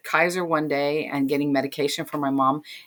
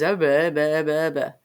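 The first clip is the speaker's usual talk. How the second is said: mumbled nonsense. Retold or imitated. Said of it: "all I hear is".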